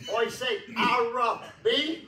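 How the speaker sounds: loud, slow reply leaning on "Ira B"; emphasis on Ira B. Retold or imitated. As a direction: draw out "Ira B" high, rising at the end. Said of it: "he would put that emphasis on that Ira B".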